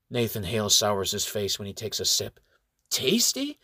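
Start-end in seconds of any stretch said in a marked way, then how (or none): 2.9-3.6 incredulous. A narrator's reading. Disgusted doubt after sipping.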